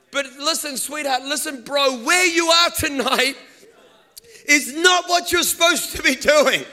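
preaching fervor. Acted. Preacher urging the crowd, rising loud appeal.

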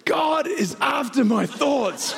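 high, slow, drawn-out cry; crazed proclamation. Retold or imitated. Pastor gone mad to his riding partner, high proclaiming cry. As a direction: proclaim it wildly at a startled friend.

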